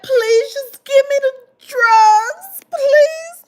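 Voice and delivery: very high, slow, sobbing beg; hammed-up tearful begging, wailing high; mock crying plea. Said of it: "I was crying".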